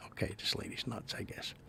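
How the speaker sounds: hushed whisper in a church pew; whispered aside. Retold or imitated. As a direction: whisper it so she can't hear. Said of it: "we were whispering".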